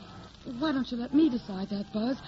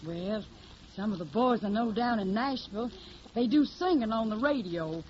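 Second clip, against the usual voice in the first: put-on hillbilly accent. Hildy poses as a Tennessee country girl, in a put-on Southern accent. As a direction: play the naive Southern hillbilly singer. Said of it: "I got the technique down pat".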